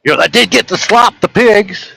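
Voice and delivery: put-on a kid proud of gross chores; imitating his son.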